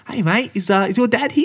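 very high, put-on polite voice; a stranger mistaking him for a kid; imagined stranger asking a boy, sweetly; friendly stranger. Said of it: "be like".

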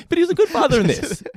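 laughing through the words. Real, not acted. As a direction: say it while cracking up. Laughing remark about Homer.

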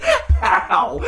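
high laughing shout; laughing outburst. Real, not acted. Friend cracks up at the Oprah joke: high laughing shout. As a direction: burst out laughing in disbelief.

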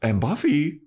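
high, nervous, half-laughing protest; whiny Xander. Retold or imitated. Xander warning the boss about Buffy, high nervous protest. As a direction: squeak out the worry, laughing nervously.

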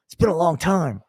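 mock eager dude. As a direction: play a needy guy begging, high and loud. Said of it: "You, you know".

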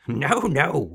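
high, loud, amused refusal; chuckling refusal. A narrator's reading. Little man amused by guess, chuckling. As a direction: relish the wrong guess. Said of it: "he chuckled".

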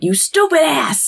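a hammed-up furious insult; mock angry yell. Bobby yelling at Dean in the junkyard, mock angry. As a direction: play Bobby snapping at Dean.

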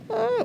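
short high pretend scream of joy; mock joyful scream. Preacher pretends to scream with joy, high and restrained. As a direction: squeal it high without any volume. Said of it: "That's me screaming really loud".

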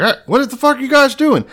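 high, loud indignant yell; a king yelling at his pursuers. Retold or imitated. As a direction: shout it at the soldiers chasing you. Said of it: "until Jehoshaphat yells out".

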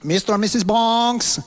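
high, put-on foreign accent; hammed-up movie character accent; Franck. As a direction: mimic the film character's comic foreign accent. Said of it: "Frank's my favourite character".

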